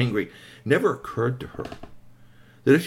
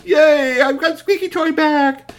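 very high, loud cheer; loud, drawn-out squeal of joy; overjoyed; 9 dB louder, slower than usual. Acted. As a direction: cheer like an elated child. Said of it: "was overjoyed".

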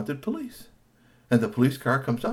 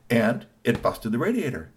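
ordinary delivery

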